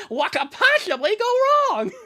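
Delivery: high, loud cartoon catchphrase; goofy, high-pitched impression, pushed hard; Bubsy. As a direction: belt out a lisping mascot tagline. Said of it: "That's my Bubsy impression".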